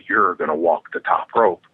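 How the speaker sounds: incredulous echo, dropped very low; deadpan disbelief. Retold or imitated. Wrestler questions opponent's plan to walk the rope, deadpan disbelief. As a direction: repeat the plan back in a low, doubting voice.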